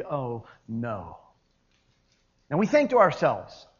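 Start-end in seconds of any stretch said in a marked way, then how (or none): 0.0-1.2 low, soft, understated dismay; hushed, deflated realization; simple, quiet. Retold or imitated. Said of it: "he just says simply".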